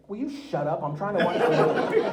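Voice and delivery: loud, laughing shush; play-scold him through laughter; laughing mock scold. To Tony at the screening, a loud laughing mock scold.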